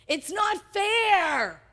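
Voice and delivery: put-on older brother sulking, self-righteous and slow; self-righteous whine. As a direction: whine it like a wronged child, slowly. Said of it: "filled with a sense of self-righteousness".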